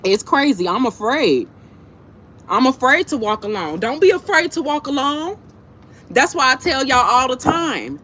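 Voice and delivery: ordinary delivery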